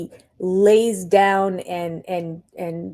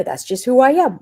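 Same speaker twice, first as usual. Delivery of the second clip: mock Cody whine; 5 semitones higher, 6 dB louder than usual. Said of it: "he's kind of the same way".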